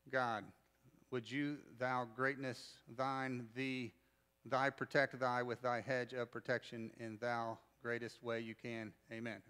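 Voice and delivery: lightly parodied King James prayer, low and soft; mock pious prayer. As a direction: make gentle fun of over-churchy praying. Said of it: "one of 'em I'll make fun of 'em".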